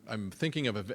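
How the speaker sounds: ordinary talk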